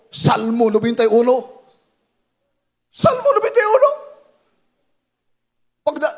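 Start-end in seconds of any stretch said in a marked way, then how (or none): 2.9-3.9 shouted. Retold or imitated. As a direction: shout the psalm as a command. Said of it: "Isigaw mo".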